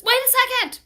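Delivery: high loud call to wait. A narrator's reading. Boy calls out to the teacher, loud and high. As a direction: shout out to stop the teacher. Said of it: "called Calvin".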